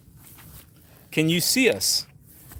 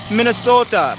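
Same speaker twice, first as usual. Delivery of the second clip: put-on Minnesota accent, pitched up; hammy go at a Minnesota accent; bad Minnesota accent; 6 semitones higher, 3 dB louder than usual. Shown as a demonstration. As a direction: attempt a Minnesota accent, badly. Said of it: "That was a horrible accent".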